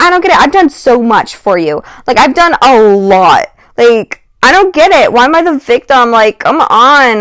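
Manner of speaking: high, loud mock whine of a self-pitying girl; whiny complaint. Imitating the girl complaining to friends.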